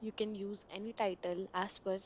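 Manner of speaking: ordinary delivery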